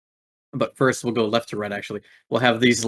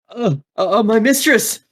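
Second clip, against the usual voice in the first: much higher, louder stammering cry; scared goon crying out to the Red Recluse; panicked stammer; 12 semitones higher, 7 dB louder than usual. Acted. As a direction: stammer out a call for help. Goon panics calling his mistress.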